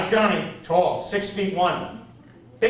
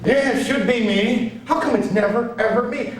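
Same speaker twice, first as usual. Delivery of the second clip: indignant whine; 7 semitones higher, 5 dB louder than usual. Retold or imitated. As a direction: protest to the universe. Complaining building up.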